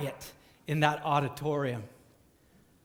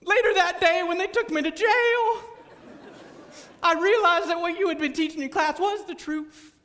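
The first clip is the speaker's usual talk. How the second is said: high, loud, pleading testimony; belting it out; 11 semitones higher, 5 dB louder than usual. Retold or imitated. Friend belts out his testimony to the whole class. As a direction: belt it out like a tearful confession. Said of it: "he belts out".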